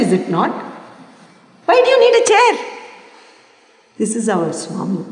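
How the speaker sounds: ordinary talk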